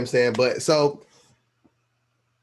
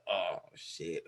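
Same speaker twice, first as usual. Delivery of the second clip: caught out; 5 semitones lower, 11 dB quieter than usual. Retold or imitated. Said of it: "I'm like".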